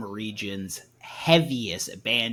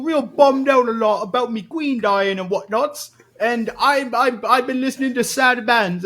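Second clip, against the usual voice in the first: high, loud put-on London accent; mock Cockney accent; 12 semitones higher, 6 dB louder than usual. Host doing a hammed-up London accent to mock a sad band. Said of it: "It's always goes Jamaican".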